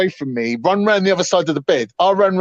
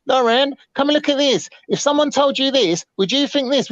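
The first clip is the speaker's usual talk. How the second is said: high mocking imitation of the girlfriend; 9 semitones higher than usual. Girlfriend drags in her daughter, voiced high and mocking. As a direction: pitch it up to mock her appeal.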